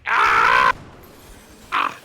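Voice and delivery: loud hammed-up monster death scream; death cry. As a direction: yell like a dying beast, low and loud. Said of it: "his death screams".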